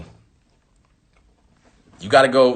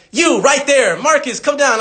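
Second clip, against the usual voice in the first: calling out a student; 10 semitones higher, slower than usual. Retold or imitated. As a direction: point him out and call him down. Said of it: "they'll literally be like".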